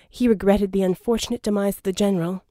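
plain reading voice

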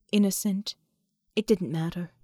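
ordinary delivery, nothing marked

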